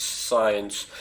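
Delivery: normal talking voice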